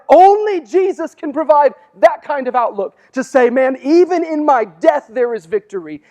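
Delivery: high, loud preacher's cry; fervent preaching. Real, not acted. Preacher declaring victory over death, high and loud. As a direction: proclaim it with fire.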